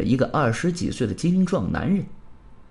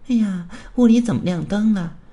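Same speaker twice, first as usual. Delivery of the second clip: higher-pitched, put-on female voice; woman outside; 5 semitones higher, 3 dB louder than usual. Woman finding the lights on at home, high surprised voice. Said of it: "门外又传来了一个女人的声音".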